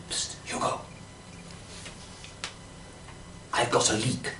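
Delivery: quiet, secretive psst to a friend; hushed voice. Retold or imitated. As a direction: lean in and speak low, conspiratorial. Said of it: "in a very low voice".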